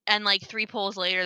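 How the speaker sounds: ordinary talk, nothing marked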